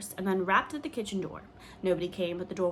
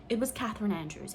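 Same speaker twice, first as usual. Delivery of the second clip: ordinary delivery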